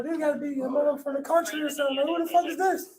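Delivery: high, incredulous who is this; shocked disbelief. Retold or imitated. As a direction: squeak it out in disbelief. Shocked at gold teeth.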